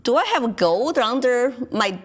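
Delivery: usual speaking voice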